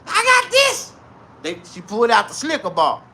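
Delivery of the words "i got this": granny voice. Acted. Granny answers kids.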